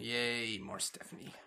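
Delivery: very soft, low, deflated "yay"; sarcastic cheer. Real, not acted. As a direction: cheer with no enthusiasm at all. Sarcastic cheer for unwanted authority figure.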